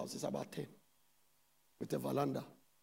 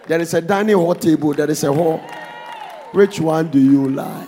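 loud preaching; 14 dB louder than usual. Acted. Pastor quizzing congregation about houses, loud questioning. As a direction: demand an answer from the church.

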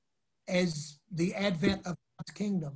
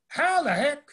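high, loud, lightly imitated Brooklyn accent; 9 semitones higher, 5 dB louder than usual. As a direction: exclaim it in a mock Brooklyn accent. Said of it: "he said in his Brooklyn accent".

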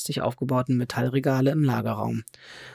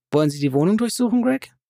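challenging tone; 7 semitones higher, 4 dB louder, faster than usual. A narrator's reading. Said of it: "in einem Ton, der förmlich schreit".

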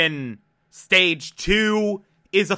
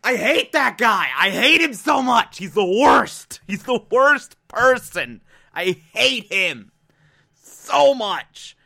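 heated rant; 5 semitones higher than usual. Real, not acted. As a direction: let the hatred out, pitched up. Venting hatred for villain.

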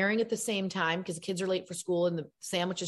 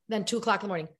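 ordinary delivery

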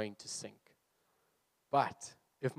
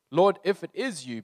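louder, higher cry of Peter; 6 semitones higher, 8 dB louder than usual. Acted. Peter crying out to Jesus from the boat. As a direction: call out to Jesus across the water. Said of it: "Peter cried".

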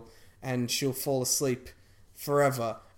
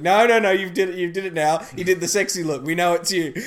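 loud, higher-pitched comic scolding; mock accusation; 5 semitones higher, 10 dB louder than usual. Accusers telling the villain they know it's her, mock outrage. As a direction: shut her excuse down loudly, half laughing.

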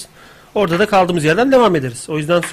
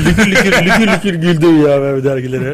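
laughing; 5 dB louder than usual. Real, not acted. Laughing while describing laughter.

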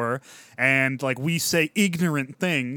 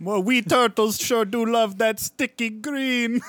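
goofy turtle voice; 7 semitones higher, 3 dB louder, slower than usual. Pretend. Mock turtle voice joke.

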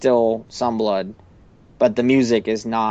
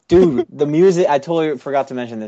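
higher, quick burst of enthusiasm; excited recall; 4 semitones higher, 4 dB louder than usual. Real, not acted. Co-host suddenly remembers the episode's music, excited. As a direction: blurt it out, pitched up, as it suddenly comes back to you.

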